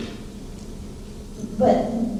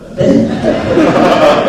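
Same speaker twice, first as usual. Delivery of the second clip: short breathy laugh; 2 semitones higher than usual. Real, not acted. Self-deprecating joke about piano.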